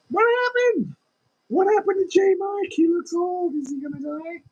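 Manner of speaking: high, loud mock alarm; imitating shocked viewers. Streamer imagining viewers shocked he suddenly aged, high alarmed. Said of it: "I'll be like".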